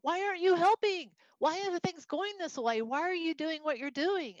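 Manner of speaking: high, loud pleading cry to God; desperate cry. Acted. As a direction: cry out to the Lord, begging. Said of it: "a prophet coming and saying".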